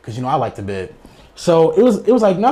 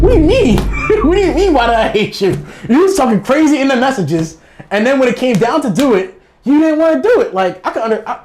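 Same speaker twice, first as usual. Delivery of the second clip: much higher and louder, indignant; exasperated disbelief; 11 semitones higher, 5 dB louder than usual. Real, not acted. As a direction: throw her words back at her in disbelief. Exasperated rant at the girl.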